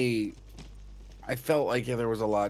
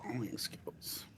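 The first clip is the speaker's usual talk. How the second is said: muttering; 14 dB quieter, slower than usual. Real, not acted. Searching for skills.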